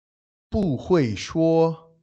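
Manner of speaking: "不会说" drawn out syllable by syllable, very slowly; very slow Chinese demo. Shown as a demonstration. Teacher slowly modelling "不会说" for listeners. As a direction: model "不会说" slowly so learners catch each tone. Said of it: "we're just gonna make it a simple".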